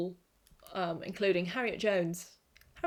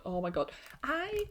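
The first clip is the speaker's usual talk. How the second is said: ordinary delivery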